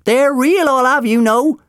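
high, loud, indignant; outraged old-timer at full volume; insistent old man. A narrator's reading. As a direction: call it out in heated protest. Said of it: "the old man insisted".

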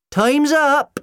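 very high, loud shout. A narrator's reading. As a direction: shout it through the closed door. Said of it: "Sally shouted from the other side of the door".

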